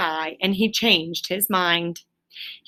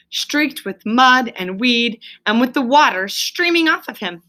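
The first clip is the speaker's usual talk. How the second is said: ordinary delivery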